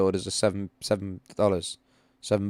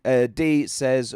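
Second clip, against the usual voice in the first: ordinary delivery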